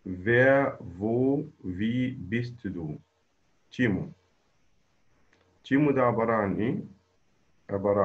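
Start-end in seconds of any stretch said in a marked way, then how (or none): 0.1-3.0 slow model reading. Shown as a demonstration. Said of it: "الجملة الأولى".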